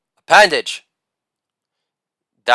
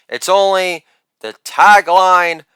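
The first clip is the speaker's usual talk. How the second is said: ordinary delivery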